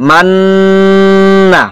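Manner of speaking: মান্না with a long, steady hum on ন; held ghunnah. Shown as a demonstration. Teacher demonstrating ghunnah to students, মান্না with prolonged ন. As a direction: hold the ন of মান্না very long, then release. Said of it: "এখানে কিছুক্ষণ সময় দূরে রাখতে হবে".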